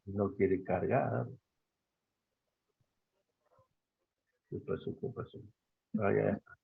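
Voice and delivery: low, very soft troubleshooting murmur; muttering to self. Real, not acted. Teacher to herself as the video won't load, muttering. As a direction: think aloud softly, well under your breath.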